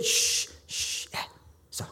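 quiet shushing; acted shh, shh; hushing. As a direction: shush them dismissively, then settle them softly. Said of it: "Så de siger".

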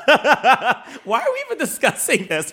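high laughing disbelief; cracking up mid-question. Real, not acted. Laughing at her co-host over the lookalike debate.

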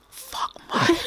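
voiceless, breath-only swear; stunned disbelief. Retold or imitated. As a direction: act out being speechless, voice gone to a whisper. Retells being left speechless by praise.

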